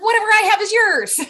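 high, loud burst of generosity; retold eager offer. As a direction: blurt it out like an eager dad. Dad's generous reply, retold.

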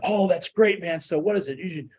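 excited uncle. Retold or imitated. Uncle on the phone, cheerfully excited about drinking. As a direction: egg him on like it's good news. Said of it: "He's just so excited about".

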